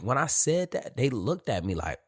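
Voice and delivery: usual speaking voice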